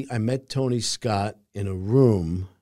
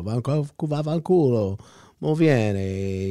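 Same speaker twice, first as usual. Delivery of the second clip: louder Italian comeback; Italian retort; 3 semitones higher, 5 dB louder, slower than usual. Retold or imitated. Actor recalling his answer in the scene, mock Italian tough. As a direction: throw the Italian insult back. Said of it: "And I go".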